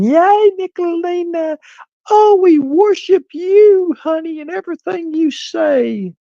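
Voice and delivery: very high, slow squealing falsetto of a gushing fan; mock fan squeal. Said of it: "jumping up and down".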